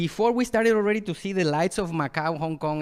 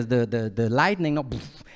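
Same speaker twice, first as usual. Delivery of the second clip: ordinary delivery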